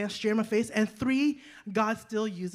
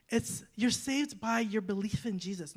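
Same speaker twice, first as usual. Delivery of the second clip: ordinary talk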